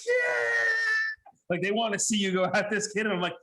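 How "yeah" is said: high, drawn-out crowd "yeah"; cheering crowd. Retold or imitated. Crowd eggs on the comedian to go at a heckler, mock cheer. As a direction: mimic a crowd egging someone on. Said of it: "and the crowd's like".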